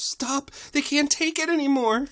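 high-pitched panicked cry; Fluttershy. Acted. Pony begging Twilight to stop, high panicked plea. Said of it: "Except Fluttershy goes".

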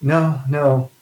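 mild mock-tearful voice; crying plea. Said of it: "she's, like, crying".

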